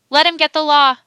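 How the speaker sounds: loud, high-pitched shouted challenge; angry-lawyer shout. A narrator's reading. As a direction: shout it across the courtroom. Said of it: "shouted Mister Rosser".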